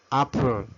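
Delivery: Apple said carefully as "AH-pol"; Apple with a clear final "l"; English pronunciation demo. Shown as a demonstration. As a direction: model Apple for learners, open "ah" first. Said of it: "cuando pronunciamos manzana".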